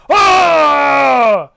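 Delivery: giant's shout. Acted. Giant reeling from Throck's hammer blow, loud pained bellow. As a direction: yell it like a giant whose hand just broke. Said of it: "And the giant goes".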